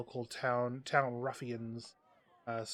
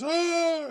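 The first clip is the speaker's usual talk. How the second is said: sudden high, loud yell; freaked-out yell; 10 dB louder than usual. Acted. Game master acts out a startled ruffian, high freaked-out yell. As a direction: burst into a mock panicked scream. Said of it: "he freaks out and goes".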